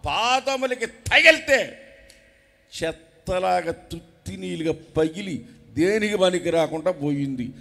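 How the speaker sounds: loud, rising sermon shout; full-force preaching. Acted. Preacher shouting to the congregation, fiery and loud.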